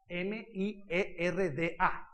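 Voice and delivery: slow, hushed, letter by letter; cautious spelling. Real, not acted. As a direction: spell it out carefully, one letter at a time. Spelling out an ugly word cautiously. (deletreo lento y prudente)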